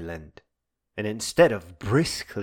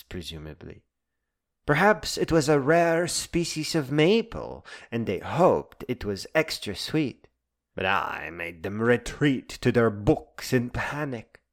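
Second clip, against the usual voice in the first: ordinary talk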